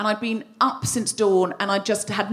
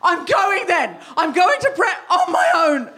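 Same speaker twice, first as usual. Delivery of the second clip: huffy snap; 6 semitones higher, slower than usual. Retold or imitated. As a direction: snap it like a sulking child. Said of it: "the way I said it was".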